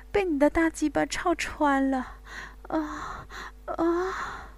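high, moaning female character voice; moaning woman. Acted. Bai Wei to her lover, high and moaning. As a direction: moan each line up high, caught up in passion.

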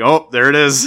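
amused, hammed-up "aha" reaction; mock sudden recognition. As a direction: call it out as if just spotted. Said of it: "And I'm like".

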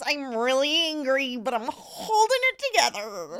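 tight, controlled Midwest mom anger; furious Midwest mom keeping it polite. Shown as a demonstration. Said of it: "my Midwest mom voice".

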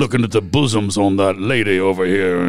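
a hint of a Southern good old boy; Southern accent. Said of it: "I was imitating your Southern accent".

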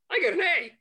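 high, loud, gloating brag with a laugh; gloating shout. Retold or imitated. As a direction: crow about the A to everyone. Gloating classmate shouting his grade.

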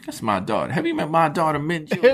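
high, prim southern lady voice; southern belle. Pretend. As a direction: introduce her like a proud belle. Southern belle mother introducing daughter.

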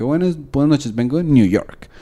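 greeting with a light English accent on New York; a touch of anglicized Spanish. Shown as a demonstration. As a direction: show off a faked gringo accent. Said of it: "como que". (saludo con un leve acento gringo)

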